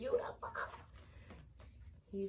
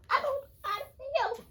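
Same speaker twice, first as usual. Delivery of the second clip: squeal; 11 dB louder than usual. Real, not acted. Squealing over idol photo.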